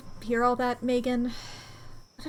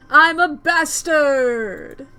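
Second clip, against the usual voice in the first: loud, drawn-out acted holler; long, slow shout to everyone below; triumphant yell; 3 semitones higher, 9 dB louder, much slower than usual. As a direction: bellow it proudly from up high. Character yelling from atop hot dog.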